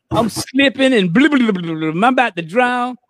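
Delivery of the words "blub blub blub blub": rapid bubbling blub-blub noise; imitated drowning gurgle. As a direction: mimic gurgling underwater while going down. Acting out himself drowning.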